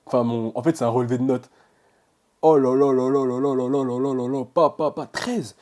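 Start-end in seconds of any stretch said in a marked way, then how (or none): 2.4-5.6 louder, rattled off in one go; giddy exclamation. Real, not acted. Student reads his transcript, giddy exclamation. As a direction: gush it in one breath. Overwhelmed by grade.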